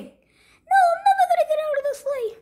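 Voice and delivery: very high, fast whiny refusal; stubborn squeaky Iggy. Acted.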